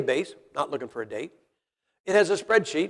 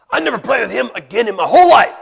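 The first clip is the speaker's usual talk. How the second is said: put-on shouted pouting kid vow; angry kid; 6 semitones higher, 10 dB louder than usual. Preacher voicing a mad kid, loud and petulant. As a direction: yell it like a sulking child.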